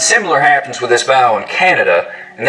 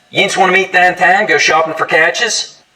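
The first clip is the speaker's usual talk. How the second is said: downtown and couches with a long ah; Pittsburgh Yinzer voice; Pittsburgh accent; 6 semitones higher than usual. Shown as a demonstration. Host doing a Pittsburgh accent. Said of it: "smoothing out to a long ah sound".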